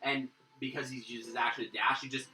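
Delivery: usual speaking voice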